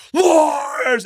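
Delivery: high, drawn-out fan holler; imitated audience shout; crowd yell. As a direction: bellow the drummer's name like a fan. Acting an audience member yelling.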